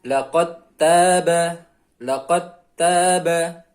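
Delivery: "laqad taba" slowly, as laqat-taba; dal merged into ta. Shown as a demonstration. Teacher models dal vanishing into ta, slow recitation. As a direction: drop the d of "laqad" into a doubled t. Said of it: "huruf dalnya hilang".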